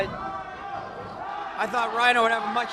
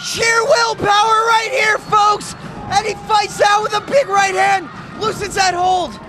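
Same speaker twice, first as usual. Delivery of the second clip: excited play-by-play; 6 semitones higher than usual. Real, not acted. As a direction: sell the comeback, building. Comeback called at full force.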